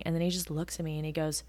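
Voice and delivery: ordinary talk, nothing marked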